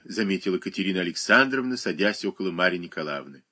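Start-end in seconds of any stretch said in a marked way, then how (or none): none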